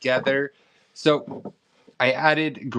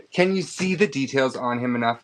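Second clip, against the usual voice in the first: normal talking voice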